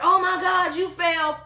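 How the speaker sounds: mocking a mother rushing to her fallen child; mock panicked mother. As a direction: overreact like a fussing mother.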